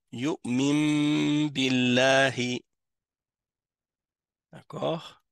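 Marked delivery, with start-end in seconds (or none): 0.1-2.6 slow chanted "Yu'mim billahi", very long hummed M; noun pronounced as mim. Shown as a demonstration. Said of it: "le noun se transforme en mim".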